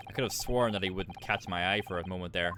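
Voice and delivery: ordinary talk, nothing marked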